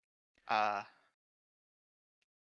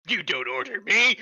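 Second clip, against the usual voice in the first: defiant shout; 6 semitones higher, 7 dB louder than usual. Acted. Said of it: "Looks at the Russian star and says".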